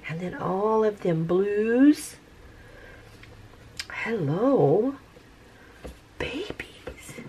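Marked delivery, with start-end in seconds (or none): 6.2-7.2 hushed tender whisper. Real, not acted. Vlogger whispering to her cells, tender whisper. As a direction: whisper to them like babies.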